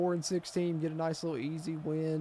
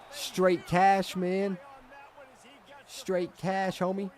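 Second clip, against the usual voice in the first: a hint of hype, higher pitched, playful catchphrase; Randy Moss catchphrase; 6 semitones higher, much slower than usual. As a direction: toss it off like a cocky receiver, higher. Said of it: "As my boy Randy Moss would say".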